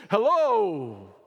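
a loud, stiff put-on hello that slipped out; formal hello. Preacher mimics how Jesus did not greet them, stiff and loud. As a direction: play a stuffy formal greeter by accident. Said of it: "I didn't intend to do that either".

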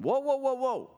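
high-pitched acted "whoa, whoa"; alarmed halting call. Preacher acts Paul halting the crowd's sacrifice, high alarmed call. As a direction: cry out high to stop a crowd, acting it.

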